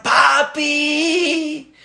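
put-on very high child's cry for rescue; dramatized high-pitched shouting for help; acted scream. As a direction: scream for daddy to save you. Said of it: "empezó a gritar".

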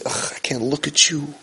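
hushed, contemptuous scolding; mock disgusted hush; disgusted rebuke. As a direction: recoil from him in quiet disgust. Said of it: "He didn't say".

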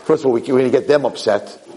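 ordinary delivery

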